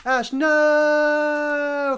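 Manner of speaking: very high, very loud cry of horror; anguished scream. Acted. Steve screams as the monster rips Ash apart. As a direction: scream her name as she dies.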